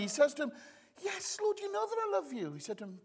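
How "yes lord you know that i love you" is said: high-pitched, insistent Peter; raised, pleading answer; earnest protesting reply. Acted. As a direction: protest your love, voice pitched up.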